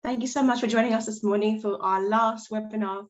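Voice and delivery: ordinary talk, nothing marked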